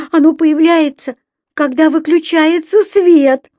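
put-on high, loud crying little girl; tearful wail. A narrator's reading. Еля explaining to her brother, tearful wail. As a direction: wail the complaint like a child in tears. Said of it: "Опять заплакала Еля".

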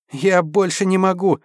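out of breath, crying out high; gasping shout, totally spent; breathless cry. Acted. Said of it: "задыхаясь, прокричала та".

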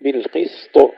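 بالقسط with a bouncing qalqala on the final ط, no doubling; bouncing final letter. Shown as a demonstration. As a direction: stop on بالقسط with a light echo on the ط. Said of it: "ننتبه أن لا نمسك السين كثيرا حتى لا نشدد الطاء". (قلقلة الطاء في بالقسط دون تشديد)

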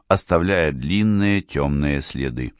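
ordinary delivery

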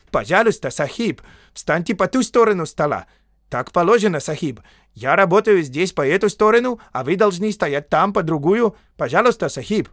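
high, loud fussy clerk; суетливый писарь-индиец. A narrator's reading. Indian clerk fussily protests. (суетливый писарь, высоко и громко)

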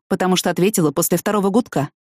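ordinary delivery

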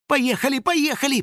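high, insistent urging; urgent hurrying. A narrator's reading. Girl rushing Тим after the van, urgent hurrying. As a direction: push him to go, pitched high. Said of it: "поторопила Тима девочка".

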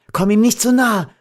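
sudden high cry of fright; alarmed warning. A narrator's reading. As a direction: warn urgently, pitch leaping up. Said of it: "als sie vor Schrecken beobachtete".

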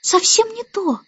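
put-on high little-girl objection; impatient child. A narrator's reading. Baby Annabel rejects the starling's guess, high and impatient. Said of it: "нетерпеливо перебила Аннабелла".

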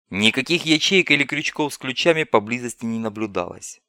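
plain reading voice

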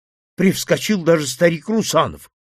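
plain reading voice

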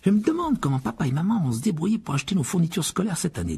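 a hint of concern about money; lightly voiced George. (George un peu inquiet)

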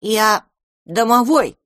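house-spirit proudly tells the cat who he is. Acted. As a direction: stand tall and declare it. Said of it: "гордо ответил".

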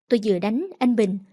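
usual speaking voice

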